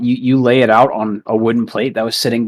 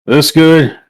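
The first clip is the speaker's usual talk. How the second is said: louder higher giant voice; gruff giant voice; 5 semitones higher, 7 dB louder than usual. Acted. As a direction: boom it like a big brute. GM voices the Goliath.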